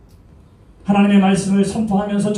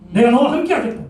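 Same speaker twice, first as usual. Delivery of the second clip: higher, emphatic declaration; raised promise; 7 semitones higher, 3 dB louder than usual. Acted. God's promise to Moses, declared high and emphatic. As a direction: proclaim it boldly. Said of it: "repeated promise, two deliveries".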